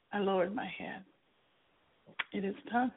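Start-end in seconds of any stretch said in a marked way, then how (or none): 2.3-2.9 quiet, resigned words to herself; said to myself. Retold or imitated. Narrator lowering her head after the boss refuses, quietly to herself. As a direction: let it fall softly as if no one should hear. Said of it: "I said to myself".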